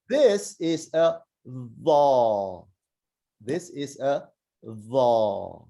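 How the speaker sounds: This is a va, slow, tongue between the teeth, cheeks puffed; slow Arabic letter sound. Shown as a demonstration. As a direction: drawl the va with a heavy, rounded sound. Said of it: "let me show you how that works".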